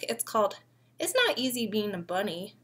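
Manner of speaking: ordinary delivery, nothing marked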